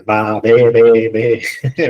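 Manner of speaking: playful baa bleating, the middle one loud; sheep bleat. Shown as a demonstration. Teacher bleats to explain the word sheep, playful. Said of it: "And what's a sheep?".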